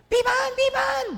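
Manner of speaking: put-on very high, loud child's cry; toddler calling out. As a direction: shout it like a small child calling. (sigaw ng batang tumatawag)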